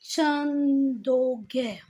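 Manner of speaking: drawn-out, slow dictation of Chandogya; syllable by syllable. Shown as a demonstration. Teacher writing it in Hindi for students, spelled out slowly. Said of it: "Hindi में कैसे लिखते हैं".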